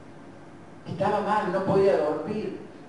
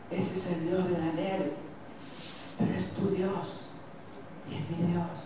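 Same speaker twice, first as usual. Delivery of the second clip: hushed worship; 8 dB quieter, slower than usual. Real, not acted. Preacher's quiet worshipful aside.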